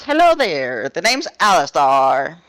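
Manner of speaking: crackly radio voice; radio static voice. Acted. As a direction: speak like through old radio static. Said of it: "but in a radio static voice".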